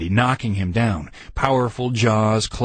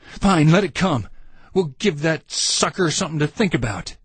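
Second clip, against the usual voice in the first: higher, cocky challenge; defiant tough soldier; 6 semitones higher than usual. A narrator's reading. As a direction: dare the monster to come. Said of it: "Randy spun around".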